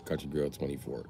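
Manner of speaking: ordinary delivery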